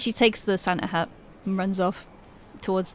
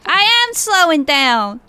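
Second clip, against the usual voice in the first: high, loud, drawn-out call; calling back; 6 semitones higher, 6 dB louder, slower than usual. Acted. Runner answers the man behind her, drawn-out shout. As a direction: shout it back, stretching the words. Tilly calls back while running.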